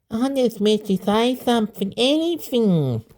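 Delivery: put-on a child explaining earnestly in a high voice. A narrator's reading. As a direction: speak up high like a little kid.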